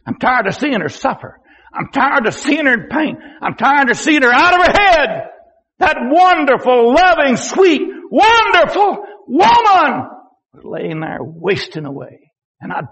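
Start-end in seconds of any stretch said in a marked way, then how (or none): 0.1-10.1 anguished plea. Retold or imitated. Son crying to God over his suffering mother, anguished building plea. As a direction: let the grief swell on each phrase.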